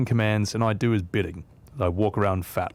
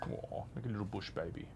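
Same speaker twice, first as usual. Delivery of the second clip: much softer fond aw; doting coo; 2 semitones lower, 17 dB quieter than usual. Real, not acted. Owner cooing at his curled-up cat, soft doting aw. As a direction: melt over the curled-up cat.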